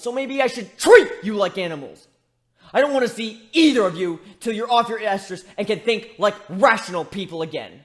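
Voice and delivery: heated, loud rant at the two who hurt her; angry tirade. Acted. Scolding the two griffins who betrayed her, furious. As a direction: scold them hard, voice rising.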